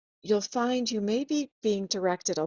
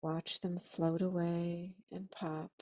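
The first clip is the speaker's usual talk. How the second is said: guided meditation. Acted.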